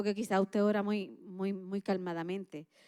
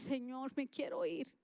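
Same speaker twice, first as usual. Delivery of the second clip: soft honest prayer; 2 semitones higher, 7 dB quieter than usual. Pretend.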